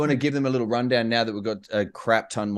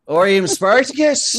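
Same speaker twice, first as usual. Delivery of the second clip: Spartacus shouted louder; dramatic shout; 7 semitones higher, 7 dB louder than usual. Pretend. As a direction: proclaim it boldly. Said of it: "No, never mind".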